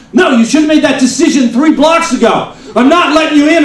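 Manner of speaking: high, loud indignant yell; angry mock shout. Furious driver refusing to let a car merge, loud yell.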